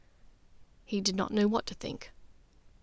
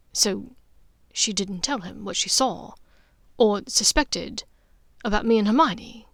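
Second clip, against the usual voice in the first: ordinary delivery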